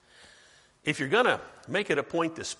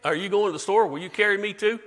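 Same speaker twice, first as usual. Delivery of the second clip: Texan quote; 5 semitones higher, 6 dB louder than usual. Retold or imitated. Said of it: "People in Texas say".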